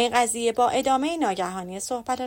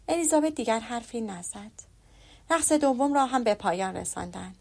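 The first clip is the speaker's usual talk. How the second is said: ordinary delivery, nothing marked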